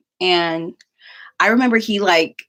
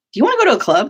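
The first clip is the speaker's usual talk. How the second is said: higher, louder, eager question; excited invite; 7 semitones higher, 6 dB louder, much faster than usual. Retold or imitated. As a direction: pitch the club idea with a burst of energy.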